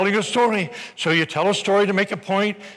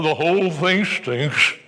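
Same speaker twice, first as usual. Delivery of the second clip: a hint of a slow, sour grumbler; lightly voiced cynic dismissing it all; grumbling thumbsucker; 3 semitones lower, slower than usual. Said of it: "one thumbsucker sitting... thinking".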